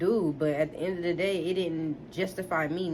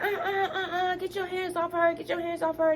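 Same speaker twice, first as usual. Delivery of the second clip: much higher, frantic mom voice with a nervous laugh; mom voiced frantic; 9 semitones higher than usual. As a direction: play mom pleading, pitched way up. Mom voiced defending daughter.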